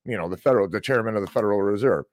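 ordinary talk

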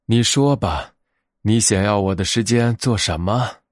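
plain reading voice

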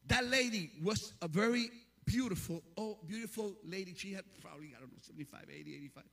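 normal talking voice

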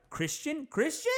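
very high, questioning squeak; mock incredulity. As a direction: repeat the name in high-pitched disbelief.

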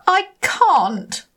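Rainbow Fish protesting. Acted. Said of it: "the rainbow fish started to say".

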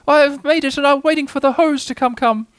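high, flustered tiny voice; flustered Piglet. A narrator's reading. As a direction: squeak out a muddled explanation. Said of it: "says Piglet huskily".

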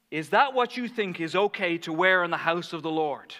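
loud mock reprimand; loud, indignant imitation of a scold. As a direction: act out a judgmental churchgoer scolding. Quoting the man scolding her.

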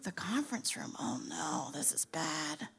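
low, hushed aside of dread; dreading whisper. Acted. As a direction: whisper it to yourself in alarm. Dread on being summoned.

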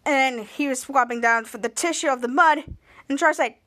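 usual speaking voice

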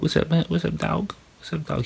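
high, soft pet voice; talking to a dog. Real, not acted. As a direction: coo at the dog while petting him. Petting and greeting his dog.

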